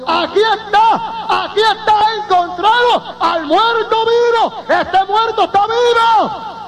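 high, drawn-out acted scream; retold screaming. Doctor announces he found the living dead man, screaming. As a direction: cry out the discovery at full pitch. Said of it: "empezó a gritar y dijo".

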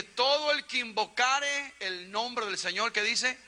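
high, drawn-out scripture recitation; preacher's proclamation. Real, not acted. Preacher recites verse, prompts congregation. (proclamación de predicador, aguda y alargada)

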